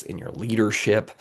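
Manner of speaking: ordinary talk, nothing marked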